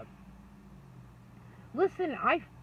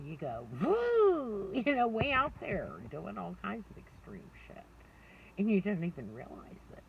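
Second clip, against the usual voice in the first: usual speaking voice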